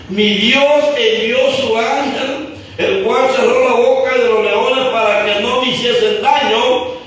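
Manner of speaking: high, slow, sustained proclamation; preaching declamation. Real, not acted. Daniel answers the king from the pit, proclaimed by the preacher. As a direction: proclaim each phrase, drawing out the endings.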